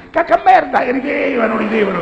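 put-on very high, loud childish squeal; kids' silly shout. Father imitates his kids shouting rude words, squealing. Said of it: "per ride facevano".

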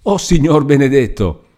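high-pitched exclamation of disbelief; astonished cry. A narrator's reading. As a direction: cry out at an unbelievable sight. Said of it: "guarda più attenta e grida".

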